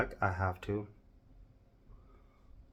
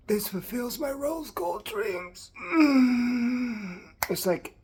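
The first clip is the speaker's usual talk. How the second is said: overjoyed; 14 semitones higher, 6 dB louder, slower than usual. Real, not acted. Gushing over the look.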